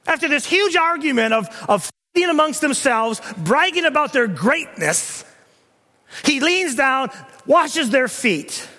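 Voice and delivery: loud, ringing pulpit delivery; thundering preacher building to a peak; preaching at full force. Acted. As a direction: proclaim it to the whole church.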